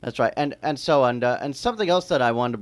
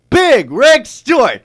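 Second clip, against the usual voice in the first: announcer call; 10 semitones higher, 12 dB louder, much slower than usual. Acted. Announcing radio guest.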